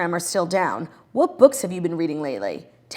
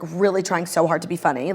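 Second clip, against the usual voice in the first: ordinary talk, nothing marked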